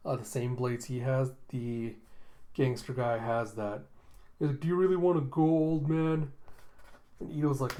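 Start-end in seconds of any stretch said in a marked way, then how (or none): 4.6-6.3 louder, higher taunting tough guy; acted thug challenge. Thug challenges the tired old man, mock taunting. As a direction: goad him into a fight.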